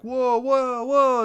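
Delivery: hammed-up shock; stunned whoa. Partner on stage hearing a reveal, mock shock. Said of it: "It's like".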